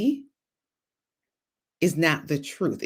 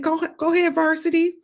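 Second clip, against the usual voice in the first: higher, louder cheer; 13 semitones higher, 4 dB louder than usual. Retold or imitated. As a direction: cheer him on with a raised voice. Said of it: "I said".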